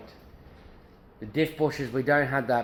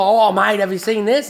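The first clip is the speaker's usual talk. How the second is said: imitating an eager salesman, higher and louder; excited mate; 9 semitones higher, 7 dB louder than usual. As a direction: play up the mate's excitement over a shiny part. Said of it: "Jamie's like".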